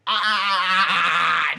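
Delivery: long, loud mock groan; hammed-up wail of dissatisfaction; acted frustrated cry. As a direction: let out a drawn-out "aah" of protest. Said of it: "And Gene goes".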